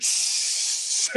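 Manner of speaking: long voiceless hiss; sustained spraying hiss; imitated water spray. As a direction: mimic a shower bursting on. Imitates shower spraying again.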